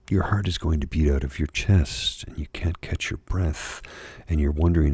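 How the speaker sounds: ordinary talk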